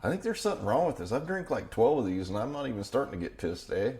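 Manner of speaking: high, swinging mock-Canadian accent; lilting Canadian caricature with a tagged-on eh; Canadian impression. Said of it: "one of them comes up and goes".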